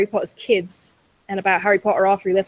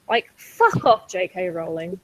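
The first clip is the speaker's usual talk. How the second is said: normal talking voice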